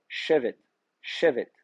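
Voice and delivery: Chevet stressed on CHE, falling away on vet; stress on first syllable. Shown as a demonstration. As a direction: say Chevet with the accent on the first e, twice over. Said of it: "il est bien sur le premier ségol".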